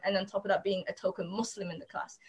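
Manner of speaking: normal talking voice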